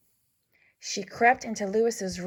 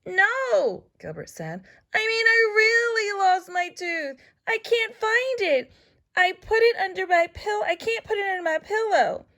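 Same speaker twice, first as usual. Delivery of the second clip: put-on upset child voice; 12 semitones higher than usual. Gilbert upset, lost tooth.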